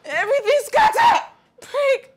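very high, loud, frantic cries; shrieked, broken-up repetitive outburst; distressed screaming. Acted. Escalating distress over the scattered room.

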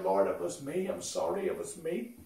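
hushed, timid admission; afraid confession. Retold or imitated. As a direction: own up softly, lightly voicing a frightened woman. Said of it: "the woman was afraid".

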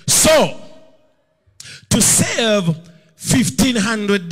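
loud, high and slow, stretching each phrase; a divine rebuke thundering down; booming voice of God. Retold or imitated. God rebuking the preacher for moving, loud and slow. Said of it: "He said".

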